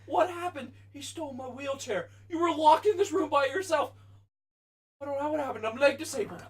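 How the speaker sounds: high-pitched, hammed-up comedy scene voice; sitcom character quote. Replaying the sitcom scene for friends, high hammed-up voice. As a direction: act out the farce in a high put-on voice.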